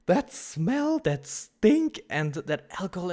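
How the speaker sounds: real disgust, high and loud; outraged disgust. Real, not acted. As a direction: build to a revolted peak. Peak disgust at the smell.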